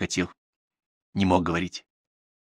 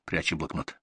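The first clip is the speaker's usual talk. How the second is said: ordinary delivery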